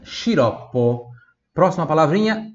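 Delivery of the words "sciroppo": sciroppo said slowly, shi-ROP-po; slow Italian teacher model. Shown as a demonstration. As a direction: draw out sciroppo.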